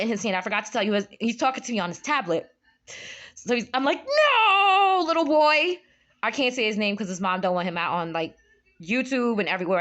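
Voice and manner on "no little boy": high, loud, drawn-out no; mock-panicked cry; retold alarmed protest. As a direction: wail it at a naughty little boy. Retold pleading with nephew to stop.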